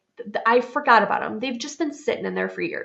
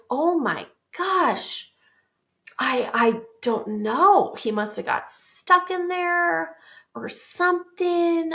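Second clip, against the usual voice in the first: slow, drawn-out, higher-pitched feigned cluelessness; playing dumb; 8 semitones higher, slower than usual. Mom covering to her son about the trapped elf, playing dumb. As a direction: stall innocently, stretching the words out.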